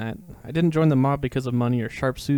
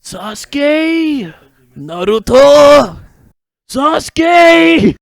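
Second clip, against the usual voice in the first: high, loud, drawn-out shouting; anime yell; 13 semitones higher, 16 dB louder, much slower than usual. Pretend. Reader bursting into Naruto anime yelling, loud shout. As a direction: bellow the names like an anime rival.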